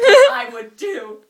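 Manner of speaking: slow, loud, dissolving into giggles; words squeezed out through laughter; laughing. Real, not acted. Reading her teen story to a friend, giggling helplessly.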